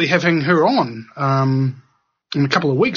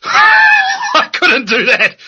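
high-pitched shrieking laugh through the words; laughing at the idea of smoking that much, shrieking high; 9 semitones higher, 6 dB louder, much slower than usual. Real, not acted. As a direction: laugh hard, voice way up and loud.

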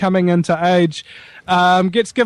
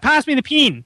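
"pin" in an Australian accent, the vowels merged into one; mock Aussie "pass me the pin"; 6 semitones higher than usual. Shown as a demonstration. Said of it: "They kind of, kind of become, uh, one".

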